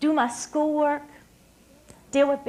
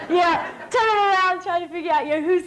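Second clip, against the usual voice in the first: laughing; 5 semitones higher than usual. Real, not acted. Laughing while retelling.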